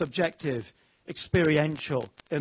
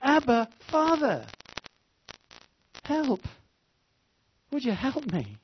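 higher, pleading call to a father; desperate cry for help; 9 semitones higher than usual. Pretend. Believer in a raging battle begging the Father, higher and pleading. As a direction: beg for help mid-battle. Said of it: "and we cry".

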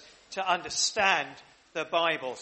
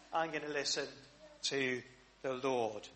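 quiet resolve; 7 semitones lower, 9 dB quieter than usual. Acted. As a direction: murmur it as a private promise. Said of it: "and saying".

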